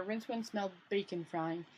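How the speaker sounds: usual speaking voice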